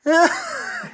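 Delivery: a sharp, high squeal of laughter bursting out; sudden high laugh. Real, not acted. Laughing at his friend sneaking in for coffee, a high squeal.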